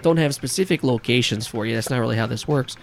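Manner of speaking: a shitty New Yorker voice, brusque; hammed-up New York accent. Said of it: "this shitty New Yorker accent".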